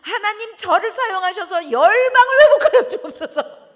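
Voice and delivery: high, loud cry of prayer; a desperate high shout to God; bursting cry. Retold or imitated. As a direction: let the prayer burst out at the top of your voice. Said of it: "찬송이 터지는 거예요".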